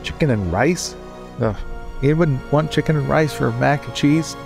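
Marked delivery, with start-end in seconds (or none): none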